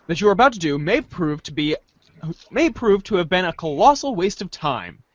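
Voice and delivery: normal talking voice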